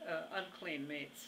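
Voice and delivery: ordinary delivery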